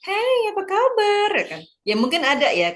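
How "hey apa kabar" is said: "Hey, apa kabar?" held high and loud on one note; exaggerated level intonation. Shown as a demonstration. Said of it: "Kalau kita kan nggak".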